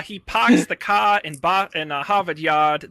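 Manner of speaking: put-on slow, punched-up Boston accent; mock Boston accent. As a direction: drop the Rs in a hammed-up Boston accent. Mock Boston line introducing the co-host.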